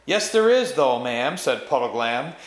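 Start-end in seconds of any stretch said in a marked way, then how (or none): 0.1-1.4 loud, high-pitched contradiction; insistent rebuttal. A narrator's reading. Puddleglum contradicting the witch about Narnia, insistent. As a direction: push back firmly, raising your voice.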